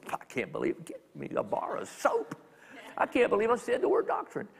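hammed-up scandalized disbelief, breaking high; exaggerated shock, from whispery gasp to high-pitched fuss; mock horror. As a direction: play appalled at your own dirty word. Mock horror at saying dirty word.

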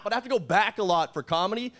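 ordinary talk, nothing marked

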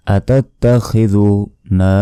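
usual speaking voice

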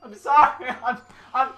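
soft, giggly apology; happy tearful laughter; laughing through tears. Real, not acted. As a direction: laugh it out, embarrassed. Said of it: "I'm just so happy".

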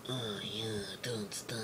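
breathy mock whisper. Man in the car murmurs to the girl, whispered. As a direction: hush it, half-mocking, intimate.

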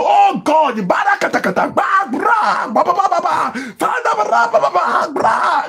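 high, shouted babbling prayer in mock tongues; mock loud tongues prayer. Pastor mocking a showy prayer in mock tongues, shouted high. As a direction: ham up a frantic show-off prayer, loud and high. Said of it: "You are there".

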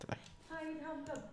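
squeaky high imitation; mock falsetto. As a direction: imitate her in a thin high voice. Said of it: "I say it like her?".

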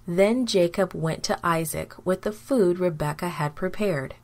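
ordinary delivery

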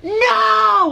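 high, loud cry of "No!"; sudden anguished high yell; retold shout. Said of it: "And I was like".

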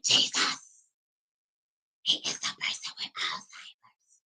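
hushed whisper to Jesus; whispered plea. Retold or imitated. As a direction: whisper your plea to heaven. Whispered prayer aside.

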